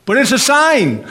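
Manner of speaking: loud, high preaching burst; emphatic proclamation. Real, not acted. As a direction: drive the key point home to the room. Preacher proclaims the key point.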